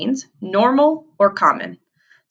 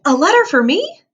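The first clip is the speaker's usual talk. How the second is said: a high, louder excited question; excited exclaim; 9 semitones higher, 4 dB louder than usual. Acted. Girl at the mailbox, excited about a letter. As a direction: exclaim with delight. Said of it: "and saying".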